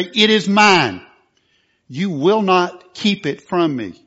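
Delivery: loud, slow, defiant claim to the devil; forceful declaration. Shown as a demonstration. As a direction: stand up and claim it with authority. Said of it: "And be forceful with him".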